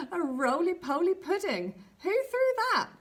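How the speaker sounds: baffled at what fell in front of him; surprised. Acted. Boy sees owl land.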